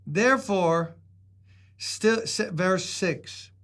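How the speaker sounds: usual speaking voice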